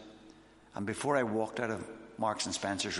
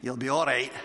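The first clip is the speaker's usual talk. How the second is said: louder, put-on Scottish voice; Scottish accent; 6 dB louder than usual. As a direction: imitate a reassuring Scottish pastor, loudly. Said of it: "in a Scottish voice".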